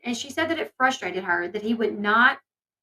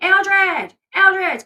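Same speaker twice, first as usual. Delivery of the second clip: yelling the name Eldridge twice, higher and louder; calling out; 7 semitones higher, 7 dB louder, slower than usual. Retold or imitated. Mother yelling for her husband to come get his plate. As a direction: shout for him from the other room. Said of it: "She's like".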